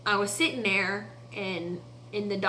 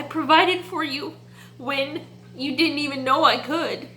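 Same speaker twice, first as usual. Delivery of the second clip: tearful reassurance; 6 semitones higher than usual. Retold or imitated. Speaker near tears while voicing God's reassurance. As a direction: hold back tears as you voice God's promise.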